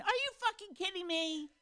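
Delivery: high, soft sneering voice; incredulous mock voice; a hint of a scoffing note. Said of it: "a note saying".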